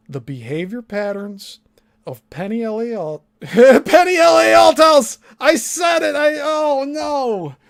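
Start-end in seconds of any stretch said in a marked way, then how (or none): 3.4-6.2 laughing, much louder, much higher shout; real shock at letting a name slip; startled outburst. Real, not acted. Blurts out a name by accident. 6.2-7.6 dismayed oh no. Real, not acted. Reacts to the slip.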